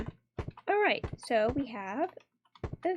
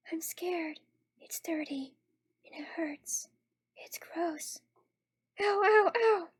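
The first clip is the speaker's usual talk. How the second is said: soft, higher, whispery pleading; frightened victim; 4 semitones higher, 4 dB quieter, much slower than usual. Acted. Trapped Misao begging for help, scared whimpering whisper.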